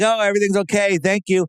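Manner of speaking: mocking the astronaut's casual thanks; breezy mock calm. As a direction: toss it off cheerfully as if nothing is wrong. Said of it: "just laughing".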